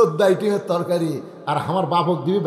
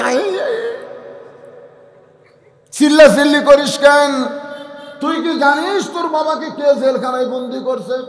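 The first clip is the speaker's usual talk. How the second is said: father hushing; 8 semitones higher, 3 dB louder than usual. Acted. Father calms shouting son.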